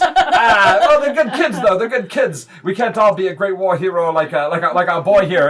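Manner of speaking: boisterous doting father; proud goblin stepdad. Acted. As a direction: brag on your boy, high and loud.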